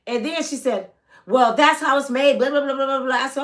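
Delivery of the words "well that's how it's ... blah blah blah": loud, high mocking whine. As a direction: brush her off with a sneer. Mocking the manager's excuse.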